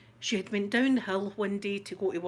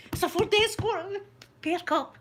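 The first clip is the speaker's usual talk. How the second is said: high, eager alert to friends; hammed-up thrilled spotting; mock excitement; 11 semitones higher, 4 dB louder than usual. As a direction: pipe up excitedly at a sighting. Pretend women spotting the car.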